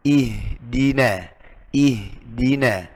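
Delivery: ihdina drawn out slowly with a plain i; slow clear ihdina. Shown as a demonstration. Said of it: "Karena ha ini berat bawaannya". (ihdina dibaca pelan dan jelas)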